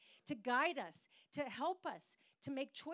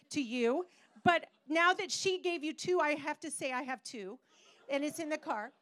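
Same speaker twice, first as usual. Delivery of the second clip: louder and higher, gushing; excited outburst; 5 semitones higher, 10 dB louder than usual. Retold or imitated. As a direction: blurt the news with rising excitement. Tina's excited gift reveal.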